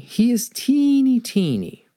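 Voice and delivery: put-on high little voice on teeny; tiny. A narrator's reading. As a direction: squeak it up to show how small. Stresses spider's tininess.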